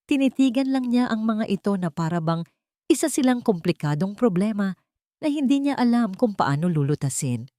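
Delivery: plain reading voice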